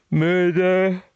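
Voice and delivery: high, loud put-on voice; hammed-up "murder". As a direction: play up the murder with mock drama. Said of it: "I see it like that".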